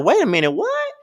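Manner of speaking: wait then a sharp leap way up on what; shocked disbelief. Retold or imitated. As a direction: caught off guard by the money talk. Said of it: "And I'm like".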